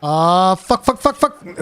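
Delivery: retelling how the AFK friend panicked; imitating friend swearing. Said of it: "he just goes".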